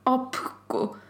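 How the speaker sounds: whispered teasing insult; hushed mocking jab. Real, not acted. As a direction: whisper it as a cheeky aside.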